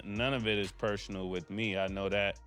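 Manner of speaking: ordinary delivery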